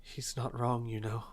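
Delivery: very soft, frail voice; frail, defeated murmur with pauses; weakly. Acted. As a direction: speak faintly, with little strength left. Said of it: "Azil in Finn's arms very weakly".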